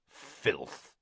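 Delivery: low, soft growl of contempt; Moody growling at Karkaroff. A narrator's reading. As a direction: spit the word out low. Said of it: "growling voice saying".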